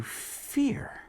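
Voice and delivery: hushed whisper for effect. Acted. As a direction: whisper the word fear. Dramatic ending word.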